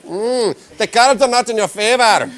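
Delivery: high, loud mystic fortune teller; ominous psychic reading the cards; Psychic Cleo voice. Retold or imitated. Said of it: "the only Psychic Cleo voice I have".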